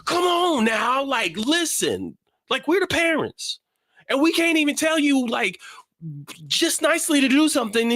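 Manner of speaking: very high, shouting frustrated parent; frustrated dad. Retold or imitated. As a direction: yell at her like a fed-up dad. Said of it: "I'm like".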